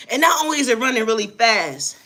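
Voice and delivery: ordinary delivery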